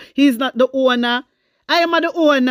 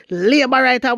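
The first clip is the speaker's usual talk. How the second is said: ordinary talk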